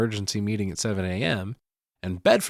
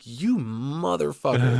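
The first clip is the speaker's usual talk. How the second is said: drawn-out, higher-pitched exclamation; half-laughing, slow-burn outrage; mock outrage; 5 semitones higher, slower than usual. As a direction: stretch the curse out in mock indignation. Own outraged reaction to retold line.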